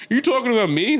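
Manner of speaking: high-pitched mock question; indignant mock retort. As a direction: act out an affronted comeback. Acting out his unwanted retort.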